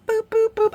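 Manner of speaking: high, loud, sing-song boops; playful boops. Real, not acted. Moving the lights in, playful boop noises. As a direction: chirp it like a toy.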